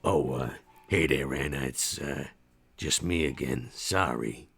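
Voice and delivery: very low, soft, apologetic mumble; low and muttered. Acted. Max reassuring a startled young girl, low and muttered. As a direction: mutter it low, careful not to frighten her. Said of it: "still low and muttered".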